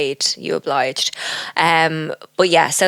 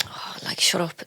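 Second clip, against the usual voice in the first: whispered shut up; 10 dB quieter than usual. Real, not acted. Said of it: "whispered aside".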